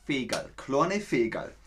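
"Fegerl" in a broad Austrian voice; gentle Austrian diminutive; Austrian accent. Shown as a demonstration. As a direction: say "Fegerl, kleine Fegerl" the Austrian way, ending in "-erl". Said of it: "im Österreichischen sind's dann die Fegerl".

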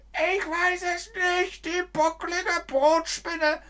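squeaky character. Pretend. A tiny character squeaks about the bread spider, in a silly voice.

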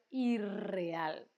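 "irreal" with a long rolled rr; double R. Shown as a demonstration. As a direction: roll out both rs in "irreal". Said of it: "dos Rs".

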